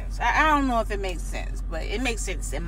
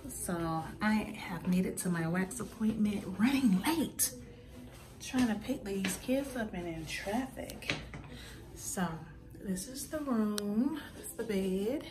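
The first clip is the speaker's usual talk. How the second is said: much softer, hushed talk; 9 dB quieter than usual. Real, not acted. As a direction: keep it hushed in the room. Quiet at the wax appointment.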